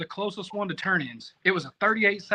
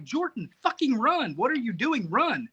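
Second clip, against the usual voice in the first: imitating a friend urging him on, higher pitch; urgent urging; 6 semitones higher than usual. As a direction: spur the runner on, retold. Retold friend urging him to run.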